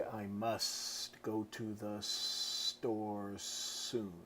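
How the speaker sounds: every S stretched out long in a slow hiss; spooky drawn-out spider speech. Shown as a demonstration. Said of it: "And so I extended the S's".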